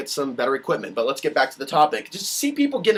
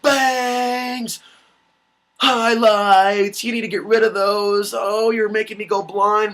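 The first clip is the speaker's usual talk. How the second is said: higher and louder, jeering a woman's looks; mock heckling shout; 6 semitones higher, 5 dB louder, much slower than usual. Mocking what people post on his page, loud heckling. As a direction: bark it out like a rude commenter. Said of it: "go to a woman's page and go".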